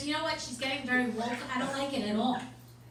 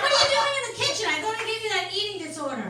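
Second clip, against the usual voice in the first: mock outraged mom; 5 semitones higher, 5 dB louder, faster than usual. Mom scolding daughter, mock.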